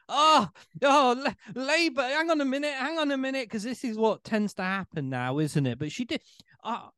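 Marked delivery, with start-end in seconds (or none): 0.1-3.5 high, excited fake astonishment; loud "oh" then quick, high-pitched interruption; mock surprise. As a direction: act out a last-second shock. Acts out mock last-second vote surprise.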